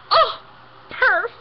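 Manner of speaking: put-on high, loud gushing teen "perf"; teen saying perf. Cadet gushing over a lesson plan, teen voice. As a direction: gush it like a sixteen-year-old. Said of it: "and she goes".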